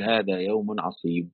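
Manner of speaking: soft, slow, resigned quote. Retold or imitated. Teacher voicing Lot with no way out, quiet resignation. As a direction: let it sink quietly, as if no way out is left. Voices a man finding no way out.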